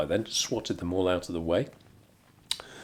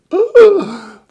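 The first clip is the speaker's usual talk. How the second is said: high loud gasp of alarm; shocked gasp; 15 dB louder than usual. Retold or imitated. Baristas panicking over wild dogs, loud gasp. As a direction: gasp as if horrified.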